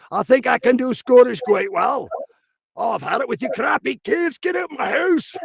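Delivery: high, indignant Scottish accent; hammed-up Scottish grouch scolding kids. Shown as a demonstration. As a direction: climb into an irate high-pitched Scottish brogue. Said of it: "the hard one to do is Scottish".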